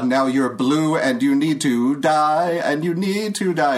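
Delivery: playful, drawn-out death threat; acted battle taunt. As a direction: taunt the enemy loudly, drawing it out. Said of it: "What would you say to him?".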